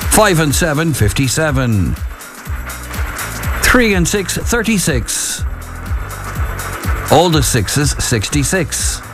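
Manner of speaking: sing-song number calls, long pauses between; bingo caller. Acted. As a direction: call each number out to the bingo hall.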